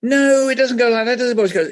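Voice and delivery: high, loud West of Ireland teacher; West of Ireland accent. Retold or imitated. Irish deputy head correcting the poet in West of Ireland accent. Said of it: "in a very strong West of Ireland accent".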